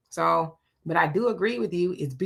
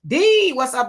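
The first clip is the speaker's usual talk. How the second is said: bright, high, louder hello; pitched-up delighted shout-out; excited greeting; 6 semitones higher, 5 dB louder than usual. Real, not acted.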